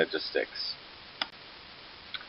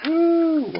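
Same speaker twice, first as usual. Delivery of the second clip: sarcastic cheer; 3 dB louder than usual. Real, not acted. Cheering after losing archers.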